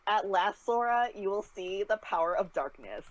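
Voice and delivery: put-on villain monologue in Valley Girl accent; Valley Girl twang on a dark speech. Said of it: "Ansem monologue in Valley Girl accent".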